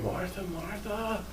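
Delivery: very soft, slow, gentle calling of her name; compassionate. Retold or imitated. Jesus gently calls upset Martha, soft and compassionate. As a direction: soothe her with her own name. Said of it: "compassionate tone of understanding".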